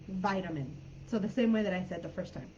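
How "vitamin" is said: "vitamin" with a long i, as in "bite"; "vitamin" opening with VITE, softly; American pronunciation. Shown as a demonstration. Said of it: "In the U S, it's".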